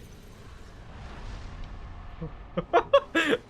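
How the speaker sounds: high airy relieved laugh; breathless laugh. Real, not acted. Streamer laughing off a near disaster, airy laugh. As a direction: let out a breathy burst of relief.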